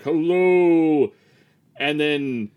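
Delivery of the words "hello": booming magic voice. Retold or imitated. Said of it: "boomed this magical voice".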